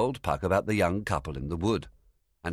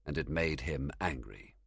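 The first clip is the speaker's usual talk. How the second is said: plain reading voice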